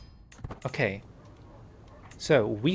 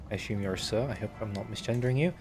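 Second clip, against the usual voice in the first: ordinary talk, nothing marked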